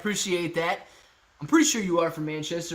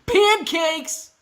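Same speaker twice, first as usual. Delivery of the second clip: loud, high exclamation; sudden shout; 13 semitones higher, 8 dB louder than usual. Real, not acted. Streamer rallying viewers to like the stream, sudden shout.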